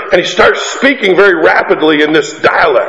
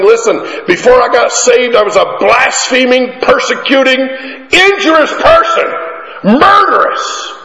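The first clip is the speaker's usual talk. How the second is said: climbing steadily higher in pitch, slowing on the last words; rising fervor; 9 semitones higher than usual. Acted. Preacher voicing Paul listing his sins, rising fervently. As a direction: build each sin higher until it peaks.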